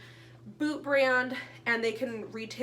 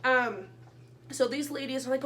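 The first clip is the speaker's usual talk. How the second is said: ordinary talk, nothing marked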